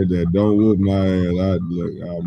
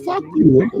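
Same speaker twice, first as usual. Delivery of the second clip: mock yell. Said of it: "we hear".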